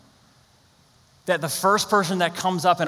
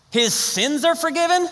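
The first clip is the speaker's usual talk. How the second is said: higher and slower, voice climbing in disbelief; stretched-out, astonished question, higher than the first take; stronger incredulity; 11 semitones higher than usual. Shown as a demonstration. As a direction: push the disbelief further on the repeat. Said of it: "Do you see this?".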